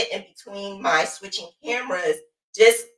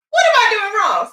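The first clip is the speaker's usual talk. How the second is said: mock frustrated cry; 12 semitones higher than usual. Voicing frustrated viewer.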